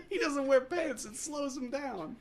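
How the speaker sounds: high mock wiseguy teasing; New York wiseguy. Imitating connected friends ribbing Barney, high wiseguy voice. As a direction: draw out the joke in a high put-on voice. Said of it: "a bunch of his old, like, New York, like, kinda connected friends".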